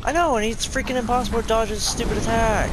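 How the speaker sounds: raging at an unfair attack; getting mad. Real, not acted. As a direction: vent real frustration at the boss. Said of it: "I got mad".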